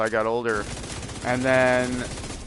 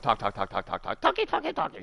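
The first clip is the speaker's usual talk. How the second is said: mock chattering grandma; 3 semitones lower than usual. Mocking grandma's endless chatter.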